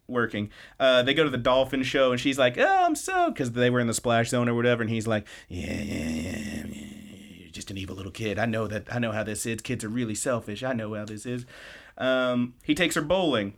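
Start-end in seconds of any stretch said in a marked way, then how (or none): 2.6-3.3 very high delighted squeal; giddy girlish squeal; thrilled squeal. Retold or imitated. Anzu thrilled at dolphin show. 5.5-11.4 low, much softer grumble; sulky teen muttering it off; grumbling dismissal. Retold or imitated. Nita grumbling, refusing to be charmed.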